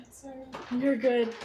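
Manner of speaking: laughing apology. Real, not acted. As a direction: giggle through it. Laughs at interruption.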